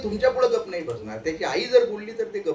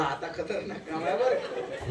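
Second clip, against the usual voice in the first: mock warning; 3 semitones lower, 8 dB quieter, slower than usual. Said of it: "म्हणलं".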